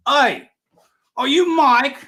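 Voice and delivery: put-on high, Aussie-sounding South African accent. South African fan greets announcer in a high Aussie-ish accent. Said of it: "Do your accent there".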